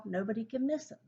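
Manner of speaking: usual speaking voice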